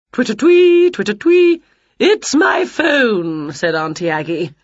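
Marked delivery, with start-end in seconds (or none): 0.1-1.6 high, loud bird-like ringtone; phone ringtone. A narrator's reading. Aunt's phone goes off, high loud twittering. 2.0-3.5 high, very slow aunt voice; trilling aunt. A narrator's reading. Aunt hears her phone ring, high drawn-out trill.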